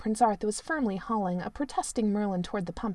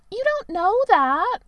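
much higher, louder whine; Merlin protesting; 11 semitones higher, 8 dB louder, slower than usual. A narrator's reading. Merlin protests to the prince that he might die.

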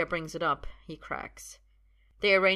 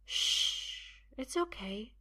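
soothing hush; 6 semitones higher, much slower than usual. Acted. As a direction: hush him gently. Sophia hushes helpless Arthur.

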